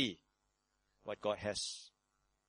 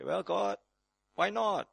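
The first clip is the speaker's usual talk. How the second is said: mock excuse-making believer; 3 semitones higher than usual. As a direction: play the careless churchgoer shrugging. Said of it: "You say".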